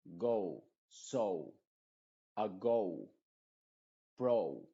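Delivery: go, so, ago, pro with a gliding English "ou"; English long o. Shown as a demonstration. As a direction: say the o as a diphthong sliding into u. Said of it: "acá vemos".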